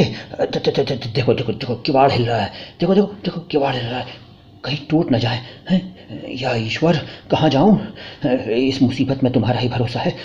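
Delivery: soft, stuttering, whispered terror; frightened husband whispering as the door shakes; terrified stammer. Acted.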